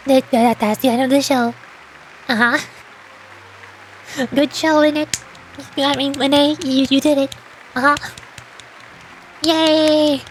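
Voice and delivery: usual speaking voice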